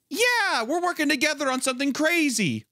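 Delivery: squeaky high excited fan voice. Retold or imitated. People gushing about the project, high and giddy. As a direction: shoot way up in pitch, giddy.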